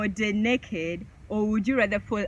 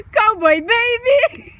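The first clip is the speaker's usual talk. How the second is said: high, loud whoop of a choice; loud, high cowboy cheer; excited cheer; 11 semitones higher, 8 dB louder, slower than usual. Real, not acted. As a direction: shout it out, thrilled.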